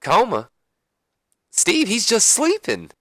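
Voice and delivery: confused, high-pitched puzzlement; slow, high mock-innocent correction; Deborah. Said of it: "a little confused, she says".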